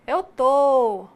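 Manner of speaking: "Eu tô." drawn out, loud and sung; sing-song mineiro reply. Shown as a demonstration. Teacher playing the friend who answers, in mineiro sing-song. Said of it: "Aí a outra responde".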